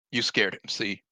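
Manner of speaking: ordinary delivery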